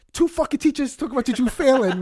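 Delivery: mock-indignant telling-off; mad mom. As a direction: imitate an exasperated mother, high-pitched. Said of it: "she comes out more mad".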